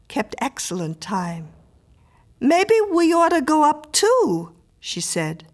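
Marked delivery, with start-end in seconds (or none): 2.4-4.5 lightly voiced woman, high and loud; neighbour woman suggesting. A narrator's reading. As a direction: float the idea in a raised voice. Woman suggests following Harry.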